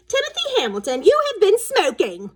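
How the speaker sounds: high, loud mock schoolteacher scolding; imitating strict teacher. Boy mimics teacher Miss Harkness, mock stern scolding. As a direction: ham up a prim teacher's reproach. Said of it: "She'd say".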